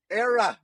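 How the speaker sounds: "Era" said like a New England "error" with the R dropped; "Era" with no final R, the Boston way. Shown as a demonstration. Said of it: "It comes out when the R is era".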